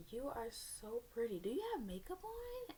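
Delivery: slow compliment climbing into a high question; admiring girl. Retold or imitated. Girl asks her about makeup, admiring and high-pitched. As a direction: imitate an admirer, voice swooping up high.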